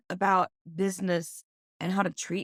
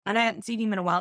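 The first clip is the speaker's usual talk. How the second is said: ordinary delivery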